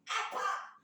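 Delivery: sharp mock shout; short, abrupt mimicked holler; imitated yell. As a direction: imitate someone yelling out of a car window. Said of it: "langsung dia teriak begini".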